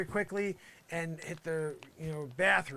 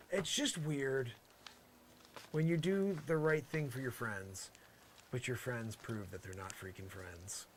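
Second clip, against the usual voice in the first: normal talking voice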